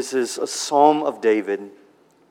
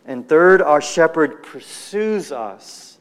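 ordinary talk, nothing marked

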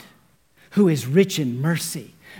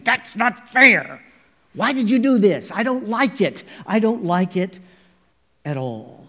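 fist shaker; 5 semitones higher, 3 dB louder than usual. Retold or imitated. Defiant man shaking his fist at God, mock angry protest. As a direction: shake your fist while you say it. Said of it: "shaking his fist in God's face and saying".

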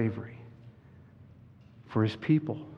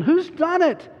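put-on raised girl's voice climbing higher; protest, building to a high-pitched cry; acted outraged cry; crying out as Susan; 4 dB louder than usual. A narrator's reading. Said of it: "cried Susan".

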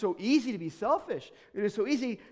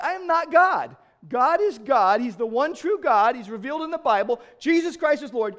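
higher and louder, firm declaration; loud insistence; 5 semitones higher, 7 dB louder than usual. Retold or imitated. Preacher insisting to a stranger that God is God, loud and firm. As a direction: plant your feet and declare it.

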